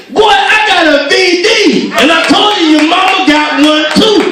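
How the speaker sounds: high, loud father-in-law insisting; yelling. Retold or imitated. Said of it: "Now he yelling".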